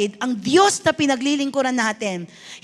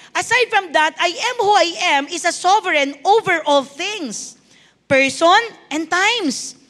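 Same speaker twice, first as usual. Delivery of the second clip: insistent, forceful preacher; preaching; 7 semitones higher, 5 dB louder than usual. Real, not acted. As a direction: drive each declaration home to the congregation. Preaches to congregation. (mariing pangangaral)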